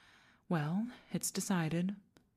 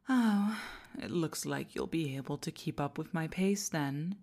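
ordinary talk

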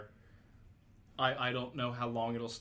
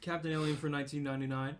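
normal talking voice

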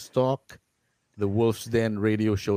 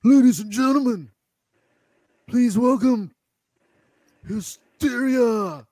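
high, slow concert announcer; Howlin' Dave impression; 12 semitones higher, 4 dB louder than usual. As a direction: stretch out the band's name like an MC. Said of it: "it sounded like him".

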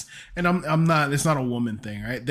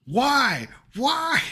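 drawn-out, high-pitched comic lament; mock anguish; 8 semitones higher than usual. Podcaster bemoans dull hurricane names, mock anguish. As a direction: wail it in exaggerated despair.

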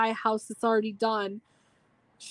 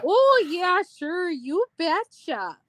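bigger, higher, slower Minnesota accent; 7 semitones higher, slower than usual. Shown as a demonstration. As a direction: ham up the Minnesota drawl even more. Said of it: "the typical Minnesota".

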